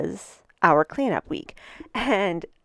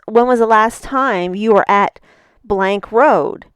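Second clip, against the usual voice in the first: louder, pointed accusation; accusing stranger; 3 semitones higher, 8 dB louder than usual. Retold or imitated. Woman at the door accusing the speaker, loud and accusing. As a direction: confront a suspect at your door.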